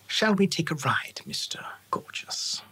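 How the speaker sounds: hushed, unhurried elf voice; whispery elf boss. Acted. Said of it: "The accent is very distinct".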